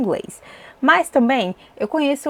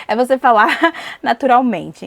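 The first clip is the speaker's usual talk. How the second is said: laughing through the words, louder at the end; 4 semitones higher, 7 dB louder than usual. Real, not acted. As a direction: giggle through the correction. Laughing at own word slip. (rindo enquanto se corrige)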